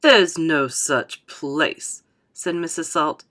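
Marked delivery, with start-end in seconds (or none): 0.0-2.0 slow, lower, emphatic dismissal; weighty, drawn-out objection; haughty contradiction. Acted. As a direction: deny it word by word. Mrs Salt contradicts Wonka.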